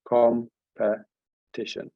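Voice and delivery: Competition drawn out slowly, each syllable set apart; syllables counted out slowly. Shown as a demonstration. Teacher counting syllables for the class, slow and drawn out. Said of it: "that's three, I make it".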